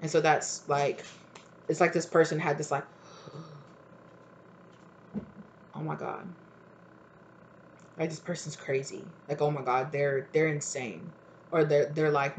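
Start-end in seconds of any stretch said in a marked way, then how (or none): 3.2-11.1 hushed shock. Retold or imitated. Shocked reaction of the person retold.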